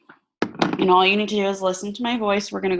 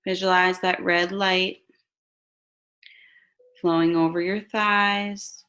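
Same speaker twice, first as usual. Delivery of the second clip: slow, calm meditation voice; guided meditation; slower than usual. Acted. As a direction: guide them gently into relaxation.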